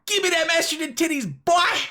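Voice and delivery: hammed-up high-pitched begging; mock demand. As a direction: beg it like a silly chant.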